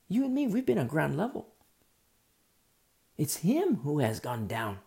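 ordinary delivery

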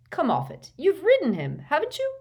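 high, incredulous retort; scoffing. A narrator's reading. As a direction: scoff at a friend's nerves. Ron scoffs at her nerves.

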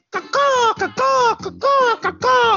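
high crow caw-caw call; chirping bird person. Pretend. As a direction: squawk like a crow. Bird calls in the jingle.

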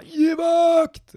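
high, loud barked command; yelled army command. Retold or imitated. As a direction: bellow it like a drill captain. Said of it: "han skrek bara".

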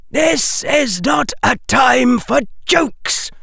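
high, slow outcry from an elder witch; indignant cry. A narrator's reading. Elder witch scolding Harry for joking, high indignant cry. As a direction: cry it out in stern rebuke. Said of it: "cried the elder witch".

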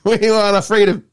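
high-pitched mocking protest chant; Harry and Meghan. Retelling a South Park spoof to mates, mocking impression. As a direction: send them up in a high, silly voice. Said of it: "they mocked the".

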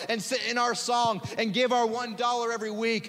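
high, loud, driving sermon climax; fiery sermon rising to a peak; preaching at full force. Acted. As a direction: hammer each line at the church.

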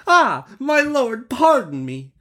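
high, slow pleading merchant; exclaimed plea. Acted. Merchant begs the genie's pardon, high pleading cry. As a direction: beg for mercy in a high put-on voice. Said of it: "exclaimed the merchant".

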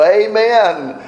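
high-pitched, celebratory response; exultant praise. Pretend. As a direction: cheer it like a joyful worshipper. Acting out joyful congregational response.

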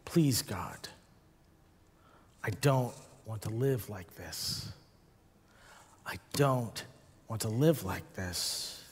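low, hushed, halting plea; quiet retold plea to God; prayer. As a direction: pray it softly, pausing between phrases. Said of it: "That became my prayer".

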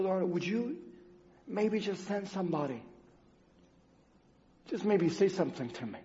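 kneeling by the bed, a quiet plea; hushed plea. Retold or imitated. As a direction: ask for one word, barely aloud. Pleading prayer by the bed.

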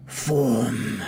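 slow, drawn-out mumbled command; low-key, drawn-out villain's mutter; mumbling villain. Acted. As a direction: mumble it as a stretched-out incantation. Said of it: "you can make out that he's mumbling".

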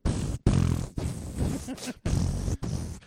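long bursts of blown raspberries; loud, sputtering mouth-made farts; imitated fart noises. Couple releasing saved farts after goodnight, imitated fart noises.

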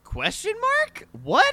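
high, incredulous shriek; incredulous high shock. Real, not acted. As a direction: squeak it out in disbelief. Shocked disbelief at beanie.

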